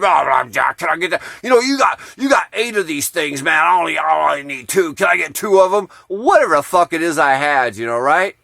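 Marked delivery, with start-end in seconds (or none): 0.0-5.9 high, loud boozy wrestler pestering; Papa Jimmy impression. Moocher pestering the storyteller in his camp, loud boozy wheedle. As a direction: imitate a drunken moocher badgering, pitched up. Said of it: "try to do an imitation of Jimmy's voice".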